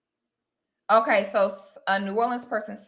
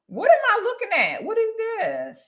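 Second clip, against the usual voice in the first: high-pitched, bewildered questions; baffled; 12 semitones higher than usual. Real, not acted. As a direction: squeal in real confusion at what you see. Baffled by search results.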